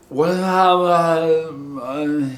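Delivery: drawn-out mumbled wail, imitating; garbled imitation. Host poorly imitating David's answer, garbled and drawn out. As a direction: imitate a slurred, stretched moan. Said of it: "I don't know if I did that well".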